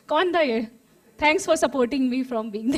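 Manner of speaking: ordinary talk, nothing marked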